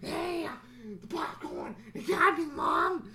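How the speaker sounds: put-on high squealing child voice; hammed-up little kid calling to Mom; excited kid. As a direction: squeal like a kid thrilled at the movies. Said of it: "they're like".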